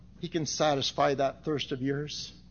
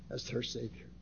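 normal talking voice

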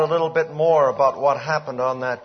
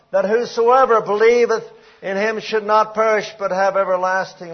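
high, loud fervent preaching; preaching at full force; 7 semitones higher, 8 dB louder than usual. Acted. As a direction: drive the point home to the congregation. Preaching John three sixteen.

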